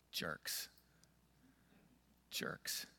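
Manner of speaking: whispered, resentful aside; muttered grumble. Retold or imitated. Worshipper grumbling about the prophet who called them out, whispered. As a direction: spit it out under your breath, unvoiced.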